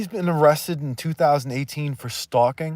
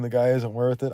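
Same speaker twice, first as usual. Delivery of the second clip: ordinary delivery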